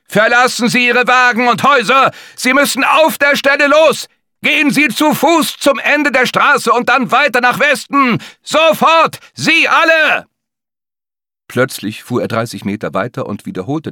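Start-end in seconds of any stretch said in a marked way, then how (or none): 0.1-10.2 high, loud barked commands through the street; police officer shouting orders at stalled drivers; urgent evacuation order. Acted. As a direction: order everyone out now, full force. Police biker orders residents to evacuate.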